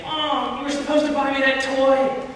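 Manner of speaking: put-on high whining kid voice; bratty child whining at mom for a toy; whiny child. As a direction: whine like a spoiled child denied a toy. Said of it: "they're saying".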